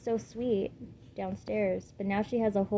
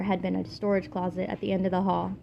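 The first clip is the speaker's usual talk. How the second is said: ordinary delivery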